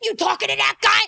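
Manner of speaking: high, overwrought mock pleading; hammed-up lovesick meltdown; jealous boyfriend. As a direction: whine and plead, climbing into a loud accusation. Plays jealous, emotional muscle man boyfriend.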